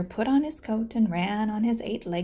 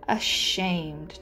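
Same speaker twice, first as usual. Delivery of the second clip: ordinary delivery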